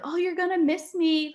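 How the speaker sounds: high-pitched imitation of a touched fiancé; a little emotional. Fiancé to crying fiancée as he moves, high touched teasing. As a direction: coo it high, mildly emotional. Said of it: "getting a little emotional".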